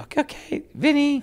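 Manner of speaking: very high, loud put-on Kelly voice; squeaky mock Kelly, hammed up; Kelly impression. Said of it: "I'll pretend I'm Kelly".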